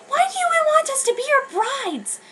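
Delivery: put-on very high, worried girl's question. Lettuce asks Ciel why, in a high voice. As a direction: plead it like a scared young girl.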